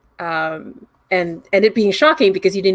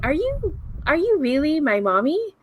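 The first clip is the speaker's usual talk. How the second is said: put-on much higher, small child's voice; little girl; 8 semitones higher than usual. Daughter meeting her long-lost mother, high hesitant child voice. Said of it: "you hear your daughter saying".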